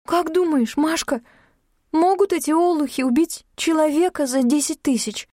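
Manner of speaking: uneasy fear. A narrator's reading. Yulka asks Mashka about the threat, uneasy. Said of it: "поёжилась Юлька".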